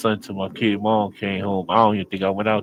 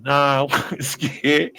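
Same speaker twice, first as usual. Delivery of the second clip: laughing denial; 5 semitones higher, much slower than usual. Retold or imitated.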